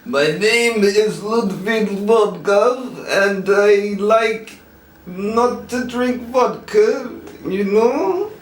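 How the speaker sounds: put-on Polish accent. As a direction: drag out a heavy Polish accent. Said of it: "I've got a good Polish".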